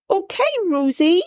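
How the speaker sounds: put-on high childlike question; small boy checking on his frozen sister; little boy's question. A narrator's reading. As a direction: ask gently like a toddler.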